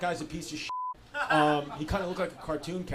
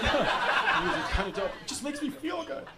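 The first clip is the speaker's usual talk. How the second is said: laughing; 7 semitones higher, 3 dB quieter than usual. Real, not acted. Laughs while admitting.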